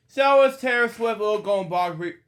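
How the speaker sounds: high, loud burst of enthusiasm; bright loud wrap-up announcement; excited announcement. Real, not acted. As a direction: announce it with a big lift.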